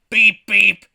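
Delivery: high, very loud horn imitation; Bus God, booming. Giant celestial bus announces itself, booming. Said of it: "yells bus god".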